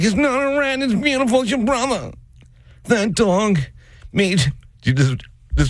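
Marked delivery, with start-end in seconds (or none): 0.0-4.5 high gushing mom voice; doting mom. Retold or imitated. Mom's high gushing voice about her dog. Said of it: "She's like".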